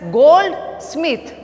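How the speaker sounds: "goldsmith" with GOLD high and strong, smith falling away; stress on GOLD. Shown as a demonstration. Teacher demonstrating compound-word stress, stress on GOLD. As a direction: hit "gold" in "goldsmith", then drop on "smith". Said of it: "word is pronounced by the stress".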